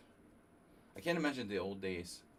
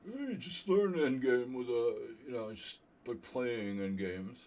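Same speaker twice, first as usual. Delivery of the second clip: higher, louder, slow put-on grandmaster voice; Grandmaster John Fedorovich impression; 3 semitones higher, 7 dB louder, slower than usual. Streamer mimics a grandmaster lecturing him on endgames, hammed up. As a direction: lay it on thick as a gruff old master. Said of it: "Grandmaster John Fedorovich".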